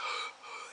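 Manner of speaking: a quick airy fake gasp; mock breathless gasp. Imitating Shawn faking being out of breath, mock gasp. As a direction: pretend to be winded for a beat. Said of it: "he's trying to pretend he was out of breath".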